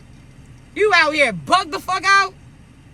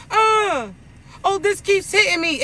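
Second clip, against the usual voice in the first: high, whiny mock complaint; mock whining; 4 semitones higher than usual. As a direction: whine like someone who won't open their eyes.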